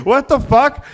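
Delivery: high, loud "what the fuck"; loud, high disbelieving yell; outraged exec. Retold or imitated. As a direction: yell it at the band, appalled. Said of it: "and the guy goes".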